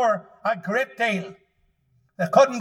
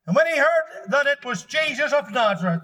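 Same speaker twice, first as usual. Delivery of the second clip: ordinary talk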